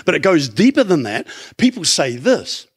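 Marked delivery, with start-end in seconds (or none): none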